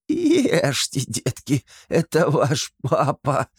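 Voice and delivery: high twanging hag voice, barely holding back laughter; nasal kikimora voice. Acted. Антон as kikimora calls her young, high nasal voice. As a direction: drone it through the nose like a swamp witch. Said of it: "прогундосил Антон".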